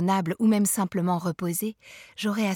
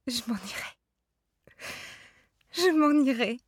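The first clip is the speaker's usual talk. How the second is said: sobbing; 5 semitones higher than usual. A narrator's reading. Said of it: "je disais entre les sanglots".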